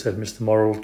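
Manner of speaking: ordinary delivery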